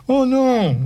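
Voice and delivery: « Oh non » gémi très haut, plus fort; un cri plaintif de pure terreur; terrified moan. Acted. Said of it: "gémit Hector terrorisé". (gémissement terrorisé, voix qui monte très haut)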